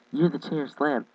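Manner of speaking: ordinary talk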